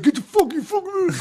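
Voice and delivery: high, garbled mock yell; high-pitched hammed-up squabbling; retold arguing. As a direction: imitate workers cursing at each other. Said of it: "they're all arguing".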